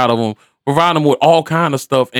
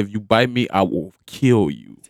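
retold threat; 5 semitones lower, 5 dB quieter than usual. Stepfather warning a puppy that snapped, retold threat. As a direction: deliver a quiet, measured warning. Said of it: "like it was a person".

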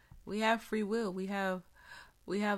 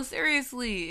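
ordinary talk, nothing marked

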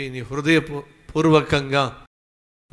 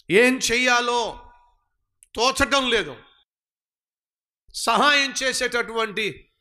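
impassioned preaching; 8 semitones higher than usual. Acted. Preacher voices David's helpless despair to the congregation, impassioned. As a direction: preach the despair with rising fervour.

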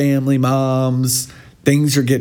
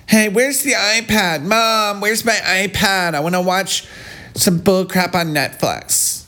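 put-on a light whiny kid voice, a bit higher; imitating the daughter; 6 semitones higher than usual. Teasing the mom's story, lightly voiced bratty kid. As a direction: play the bratty daughter asking for her iPad. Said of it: "Or did she say".

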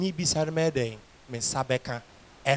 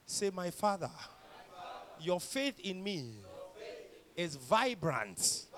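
usual speaking voice

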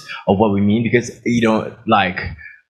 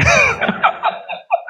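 burst of high laughter. Real, not acted. Laughs at the misunderstanding.

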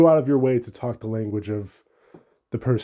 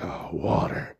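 much lower, disdainful grumble at water; disgusted fire dad; 8 semitones lower, 6 dB quieter, slower than usual. Retold or imitated. Host mimicking Bernie's disdain for water, low sneer. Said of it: "But he's like".

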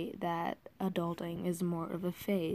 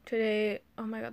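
ordinary delivery

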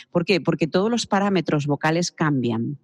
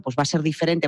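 ordinary delivery

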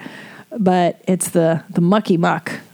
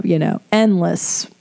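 ordinary talk

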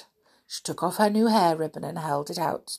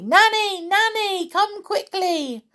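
calling out loudly; 13 semitones higher, 3 dB louder than usual. Acted. Amelia Jane calls Nanny for help, calling out. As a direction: call urgently for help from afar. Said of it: "She called loudly".

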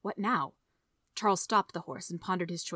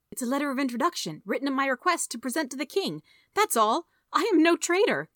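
high, urgent self-defence building louder; indignant denial; 7 semitones higher, 4 dB louder than usual. A narrator's reading. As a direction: protest your innocence, rising to an outburst. Accused man defends himself to mayor.

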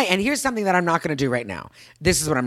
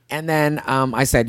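ordinary talk

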